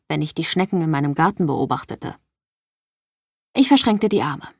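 ordinary delivery, nothing marked

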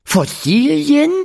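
put-on boy echoing the teacher, Fossilien high and rising; surprised question. A narrator's reading. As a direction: echo Fossilien as if amazed. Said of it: "fragte Silas".

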